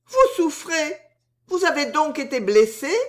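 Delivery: voix aiguë et émue de jeune servante; compatir, aiguë et tendre; soubrette émue. A narrator's reading. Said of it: "réellement émue". (Susan s'inquiète de la blessure, voix aiguë émue)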